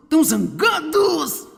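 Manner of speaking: hammed-up angry voice, climbing high and drawn out; mock anger. Preacher acts out believers who are so angry, exaggerated and comic. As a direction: drag out the words in pretend fury. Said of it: "eu pareço tão zangado".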